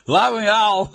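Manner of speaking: laughing, high, "El" said short for "ele"; mock Portugal accent. Joking about the Portuguese "ele", laughing mock accent. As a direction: giggle through the Portuguese "El". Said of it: "O ele lá é El".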